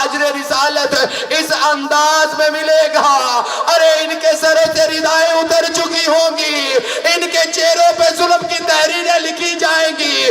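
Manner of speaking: high, impassioned lament recitation, near shouting; preaching at full force. Acted. Preacher to mourners at a majlis, impassioned wailing lament. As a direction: pour grief into the telling of the tale. Climax of the masaib.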